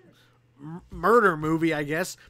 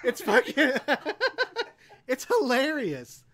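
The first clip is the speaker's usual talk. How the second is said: helpless laughter breaking up the words; real laughing; 5 semitones higher, slower than usual. Real, not acted. Cracking up with co-host over a terrible film, laughing. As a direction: laugh through it, higher and drawn out.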